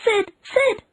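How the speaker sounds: put-on high, strained boy's whisper-call to wake Sid; a high thin call to his sleeping brother. A narrator's reading. Tom trying to wake Sid, high and urgent. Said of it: "He said".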